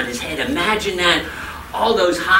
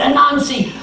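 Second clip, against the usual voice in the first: higher, louder, urgent calling; urgent neighbors; 8 semitones higher, 4 dB louder than usual. A narrator's reading. Neighbors pounding on Anansi's door, urgent calls. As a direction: call through the door.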